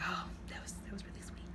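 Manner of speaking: soft, breathy whisper, moved; touched whisper. Retold or imitated. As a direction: sigh it out, touched by the scene. Own touched reaction.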